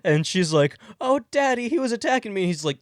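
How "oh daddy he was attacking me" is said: high, hammed-up daddy's girl; pleading daughter. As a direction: plead to Daddy in a much higher voice. Daughter pleads to cop father.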